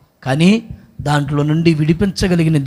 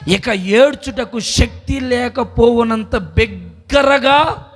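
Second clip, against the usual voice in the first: high, slow, drawn-out verse; solemn slow quote of the verse; slow scripture reading; 5 semitones higher, much slower than usual. Real, not acted. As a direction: lay each word down slowly. Reading scripture slowly, emphatic.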